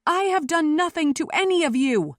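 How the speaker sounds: high, loud protest; shouted denial. A narrator's reading. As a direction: shout to be heard above a roaring crowd. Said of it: "Seneth shouted".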